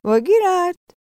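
loud, high-pitched conductor's holler; full-voiced announcing shout. A narrator's reading. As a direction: holler the stop name. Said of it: "biletçi bir daha bağırdı".

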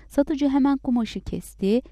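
usual speaking voice

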